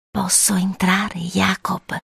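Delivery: slow, soft whispered request; mother's whisper. A narrator's reading. Vampire mother asks to enter Jacob's room, whispering. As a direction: whisper slowly, asking to be let in. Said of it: "sussurrò lei".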